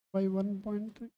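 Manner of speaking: normal talking voice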